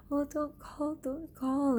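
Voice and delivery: very high, small pleading voice; imitated scared pleading. Girl freaking out, begging the teacher not to call, imitated fear. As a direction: beg in a high mimicked panic. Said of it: "she was so scared and freaking out".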